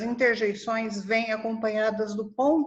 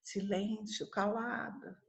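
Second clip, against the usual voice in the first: much softer "silêncio, calada"; hushed command; 11 dB quieter, slower than usual. Shown as a demonstration. Teacher showing silence interjections, softly hushing. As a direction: quietly hush the class. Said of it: "De silêncio".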